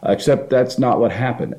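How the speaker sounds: ordinary delivery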